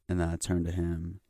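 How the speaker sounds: ordinary talk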